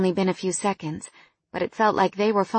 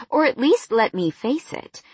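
ordinary delivery, nothing marked

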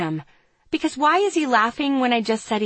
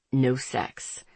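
lower, smooth male voice; Andre's voice; 7 semitones lower, 4 dB quieter than usual. A narrator's reading. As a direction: read him low and cool.

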